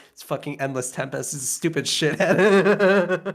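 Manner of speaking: deadpan monotone, cracking into a laugh. Pretend. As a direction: say it flat and bored. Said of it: "with the most monotone voice".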